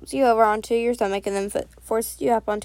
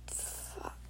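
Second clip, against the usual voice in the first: very soft, voiceless exclamation; barely audible whispered reaction; whispered curse; 24 dB quieter than usual. A narrator's reading. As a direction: whisper it under your breath. Inner reaction voiced under the breath.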